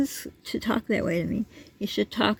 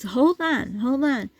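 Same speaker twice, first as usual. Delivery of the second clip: raised-pitch retold call; urgently calling him back; half-loud retold hold on; 6 semitones higher, 4 dB louder, slower than usual. Retold confrontation at the door.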